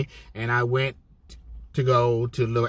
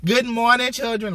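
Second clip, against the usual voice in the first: much higher, sing-song good morning; cheery greeter; 11 semitones higher than usual. Retold or imitated. Aide showing her cheerful morning greeting to the kids, very high and sing-song. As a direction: greet the kids like a chirpy host. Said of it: "that's how I am".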